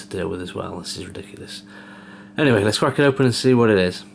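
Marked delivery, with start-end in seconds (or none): 0.0-1.6 whispered, fed-up aside; muttered under breath. Real, not acted. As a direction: mutter it to yourself, exasperated. Fed up with cardboard boxes.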